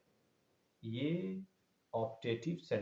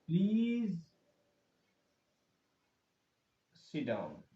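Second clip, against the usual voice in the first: polite request; 3 semitones higher, slower than usual. Shown as a demonstration. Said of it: "आपको request किया जा रहा है".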